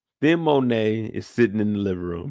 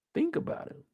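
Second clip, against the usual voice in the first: whisper; 9 dB quieter than usual. Real, not acted. Conspiratorial aside to viewers.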